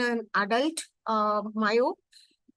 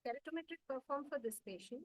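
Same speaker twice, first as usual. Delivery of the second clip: very faint, far from the mic; faint speech, hard to hear; barely audible; 3 semitones higher, 19 dB quieter than usual. Real, not acted. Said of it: "your voice is very low".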